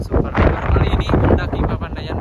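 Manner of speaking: loud excited call in wind; shouting over wind. Real, not acted. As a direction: push voice over gusts. Strong wind on the summit. (berteriak melawan angin kencang)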